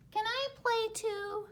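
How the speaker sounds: blue bird asking to join; little bird voice. Acted. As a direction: ask sweetly as a tiny bird.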